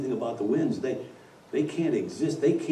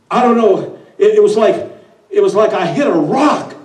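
higher, louder, rushed stammering excuses; nervous underling babbling to the boss; flustered wind; 11 semitones higher, 11 dB louder than usual. Acted. The wind excuses itself to the devil, flustered and stammering.

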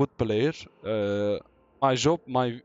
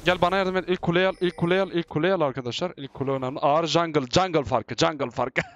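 ordinary talk

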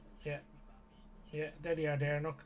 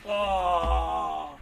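startled shout; 2 semitones higher, 11 dB louder than usual. Real, not acted. Grenade blast in firefight.

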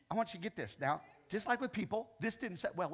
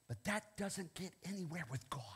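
whisper; 8 dB quieter than usual. Real, not acted. Whispered aside after boast.

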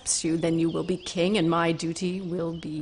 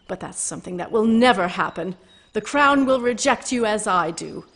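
defiant outburst; 2 semitones higher, 7 dB louder than usual. Acted. Mergil defying Kreia.

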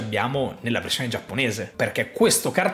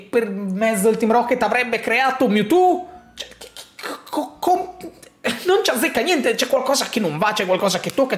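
incredulous outburst; 8 semitones higher than usual. Real, not acted. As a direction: voice shoots much higher, stammering in disbelief. Theory falls apart, incredulous outburst.

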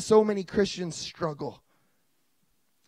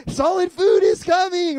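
high, loud, slow announcement; mock excited; 9 semitones higher, 7 dB louder than usual. Dad announcing food to baby, high and loud. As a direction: call it out like a thrilled feeder.

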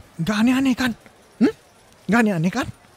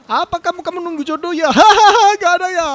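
loud, high mock wonder; loud laughing mock commentary; hammed-up excitement; 8 semitones higher, 8 dB louder, faster than usual. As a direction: play up the drama.

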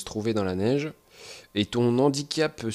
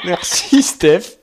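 sarcastic thanks; 6 semitones higher, 9 dB louder, much slower than usual. Pretend. Mock thanks for a joke.

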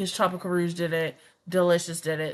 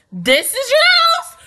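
a high, loud, amazed question; surprised; 17 dB louder than usual. Real, not acted. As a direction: shriek it in disbelief. Reacting to a rich house.

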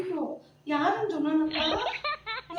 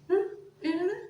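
usual speaking voice

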